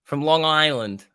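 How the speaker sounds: put-on loud, slow, half-successful Long Island accent; attempted Long Island accent. Streamer trying the accent he can't do, attempted Long Island accent. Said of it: "I can't even do the accent".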